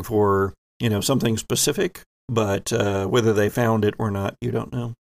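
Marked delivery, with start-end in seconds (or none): none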